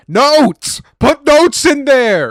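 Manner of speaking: high, very loud mock-furious yell; exasperated shouting. Ranting at the game over the journal menus, exasperated shouting. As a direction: yell it as a comic, pleading outburst.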